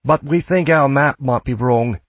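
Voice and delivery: put-on high-pitched older woman's character voice; older woman traveller. A narrator's reading. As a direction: pitch it well up as the friendly traveller.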